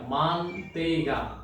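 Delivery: মানতেইগা drawn out very slowly; slow repeat. Shown as a demonstration. Teacher repeating butter in Portuguese slowly for students. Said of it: "লেখা আছে দেখেন".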